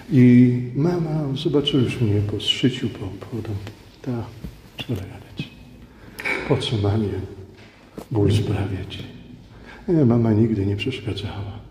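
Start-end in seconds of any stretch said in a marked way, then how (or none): none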